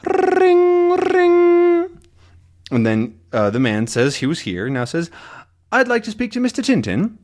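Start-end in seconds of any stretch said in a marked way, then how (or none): 0.0-2.0 very high, loud trilled bell; shrieking bell imitation, held long; imitated ring. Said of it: "we hear a ring". 5.7-7.2 high-pitched, quick character voice; deaf visitor. Acted. Voices the hard-of-hearing visitor.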